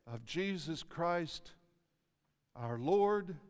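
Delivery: ordinary delivery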